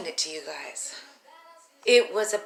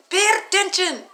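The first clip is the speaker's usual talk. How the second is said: higher, louder teacher scold; stern teacher; 11 semitones higher, 8 dB louder than usual. Retold or imitated. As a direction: snap like a strict teacher.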